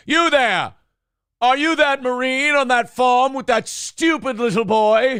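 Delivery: high, haughty British officer; British officer accent. Acted. Actor slipping into his old villain role, high British accent. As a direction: call out imperiously in a hammed-up British accent. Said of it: "Jason, you're fucking it up again. Remember your accent".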